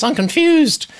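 high-pitched, hammed-up confusion; mock bafflement. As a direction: act out a baffled viewer, pitch leaping up. Acts out a viewer's baffled comment.

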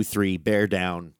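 normal talking voice

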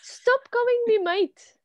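high, loud playful objection; exasperated protest. Real, not acted. Co-host objecting to being called mate, exasperated. Mock quarrel over a nickname.

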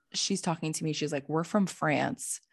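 ordinary talk

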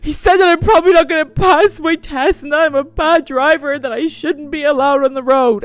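high, loud mock sobbing; imitating sister crying. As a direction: wail the complaint like a crying teen. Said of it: "She goes".

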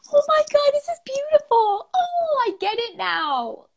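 high, breathless gasp of wonder; overwhelmed awe. Real, not acted. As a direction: swept away by how beautiful it is. Moved reaction to the poem.

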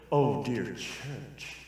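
slow, softened, mock-eloquent preacher; hushed and measured, parodying polite preaching; eloquent demo. Shown as a demonstration. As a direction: demonstrate a genteel sermon with long, careful pauses. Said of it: "said that more eloquent".

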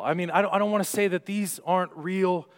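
ordinary delivery

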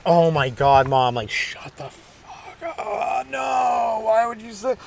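drawn-out agonized wail; comic groan rising high and thin; mock mortified. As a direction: wail in hammed-up teenage embarrassment. Retold agony at parents' teasing.

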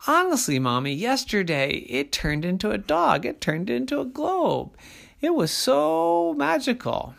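put-on high earnest child's voice; pleading boy. Jimmy pleading with Mommy that the yo-yo was magic. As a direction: beg her to believe you.

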